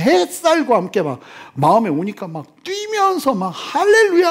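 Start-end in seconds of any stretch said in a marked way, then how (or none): 2.6-4.3 high, loud hallelujah shout; joyful shout. Retold or imitated. Retelling a healed man leaping, joyful shout. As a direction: cry out hallelujah with joy.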